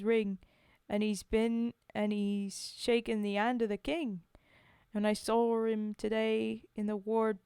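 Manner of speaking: put-on Cockney storytelling voice; homely Cockney verse monologue; Cockney accent. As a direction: recite it like an amateur Cockney. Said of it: "Cockney British one".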